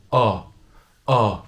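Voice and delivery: o said slowly as a long open vowel; slow textbook pronunciation. Shown as a demonstration. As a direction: stretch the open O, clearly. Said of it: "It's more like an open O".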